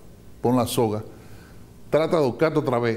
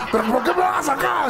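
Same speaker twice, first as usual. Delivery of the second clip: whiny despairing man; 13 semitones higher than usual. Acted. Rescued man protests being saved.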